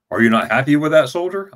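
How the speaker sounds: imitating an indignant sergeant; army superior. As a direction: challenge him like a drill sergeant. Retold army superior challenging recruit.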